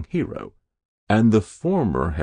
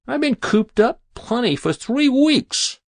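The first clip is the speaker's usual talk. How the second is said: very high-pitched grumbling; fed-up lout; 4 dB louder than usual. A narrator's reading. Hero griping about being cooped up, fed up. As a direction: complain in a high, exasperated voice.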